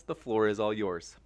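ordinary delivery

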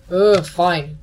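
loud exasperated ugh; rolling eyes. Real, not acted. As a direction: give in with an eye-roll groan. Annoyed at dark room.

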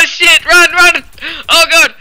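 panicked shouting, running for it; being chased. Real, not acted. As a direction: yell in a frantic rush to escape. Hider found and chased in game.

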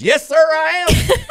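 high, loud, slow and proud; excited reply. Retold or imitated. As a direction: beam it out like a thrilled kid. Dale's happy reply acted out.

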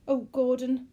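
high, loud, distressed woman; voiced woman on the verge of tears; quivering. A narrator's reading. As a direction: wail it to your husband, upset. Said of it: "the woman's lips quivered".

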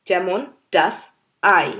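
usual speaking voice

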